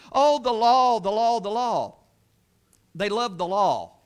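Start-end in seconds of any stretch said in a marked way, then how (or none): 0.1-2.0 a mock gushing voice, louder on the oh; hammed-up adoring sigh; mocking the Pharisees. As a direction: parody their swooning over the law. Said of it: "they had such emphasis on".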